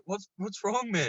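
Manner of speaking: high-pitched challenge, re-enacted; retold confrontation. Driver to the cyclist who came over, high retold challenge. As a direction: pitch it way up, calling him out.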